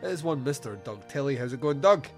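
ordinary talk, nothing marked

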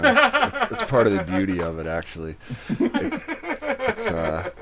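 talking through real laughter; breaking up laughing mid-sentence; giggly, fast words through a laugh. Real, not acted. Cracking up at the remark.

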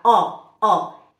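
“ó” isolado, forte e aberto. Shown as a demonstration. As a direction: demonstre o ó bem aberto. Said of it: "É um O aberto". (“ó” curto, aberto e forte)

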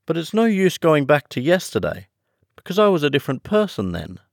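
high, explaining Alice; high storybook heroine, hurrying at the end. Acted.

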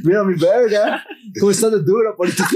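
mock lewd come-on. Joking lewd tourist impression.

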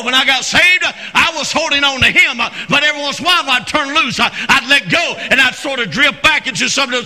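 high, loud, driving revival preacher; shouted Southern pulpit delivery; full-force preaching. Real, not acted. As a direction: preach it out with mounting fervor.

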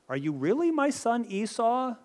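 high, searching question in a retold father's voice; doubtful questioning. Blind Isaac tests which son this is, doubtful. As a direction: lift the pitch right up in disbelief.

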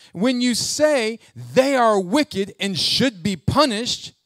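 high, loud scripture reading, heavy on the words; full-force preaching. Acted. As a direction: drive the verse at the congregation. Reads Paul at full force.

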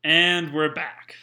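slow, loud host voice re-opening the show; hammed-up host announcing the return; radio host restart. Re-opening after an interruption.

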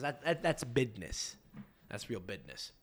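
"bidness" drawn out slowly, voice sinking low and soft. Shown as a demonstration. Host joking about business: slow low "bidness". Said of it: "That's not business".

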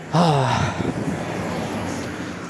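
long, soft sigh out; weary sigh. Real, not acted. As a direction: let out a tired sigh. Regretting having to walk back.

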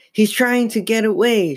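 high-pitched, urgent protest; alarmed. A narrator's reading. Girl warning her parents about the dummy, alarmed. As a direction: blurt out the danger. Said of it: "she stammered".

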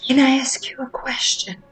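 imitated barely audible whisper; super-light whisper. Stranger asking a shopper from her car, very light whisper. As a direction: mimic someone whispering a request. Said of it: "super lightly".

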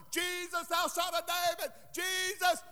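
high, held-back holler; mock hollering. Preacher acts blind Bartimaeus calling Jesus, mock hollering. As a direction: call out high without full volume. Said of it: "He started hollering".

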